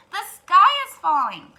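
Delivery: loud, high alarmed cry; panicked cry. Acted. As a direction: shout the alarm together. Birds cry together.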